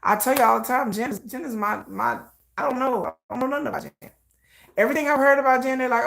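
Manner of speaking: ordinary talk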